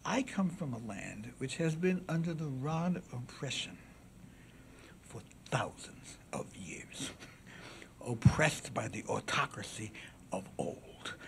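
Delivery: slow, hushed, weighty declamation; classical oration. Acted. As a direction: let each phrase hang before the jury. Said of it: "was classical oration".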